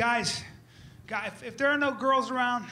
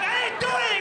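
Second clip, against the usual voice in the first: high-pitched mock pleading; frantic denial; 12 semitones higher, 4 dB louder than usual. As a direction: protest loudly mid-scuffle, voice jumping up. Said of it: "And I'm like".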